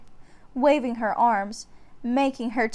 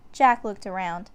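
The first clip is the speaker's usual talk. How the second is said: ordinary delivery, nothing marked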